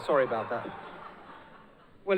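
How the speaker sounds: ordinary talk, nothing marked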